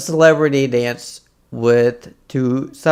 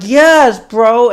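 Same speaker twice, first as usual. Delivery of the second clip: high, loud emphatic agreement; imitating waitress; 10 semitones higher, 9 dB louder than usual. Waitress confirming the story, high and loud. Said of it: "the waitress is like".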